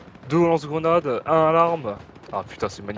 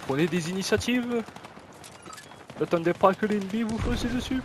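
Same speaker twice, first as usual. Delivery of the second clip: mock German accent, pitched higher; 9 semitones higher than usual. Gamer urging passive teammates forward in a higher mock German accent. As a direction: bark orders to the team in a put-on German accent. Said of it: "Pourquoi je prends l'accent allemand".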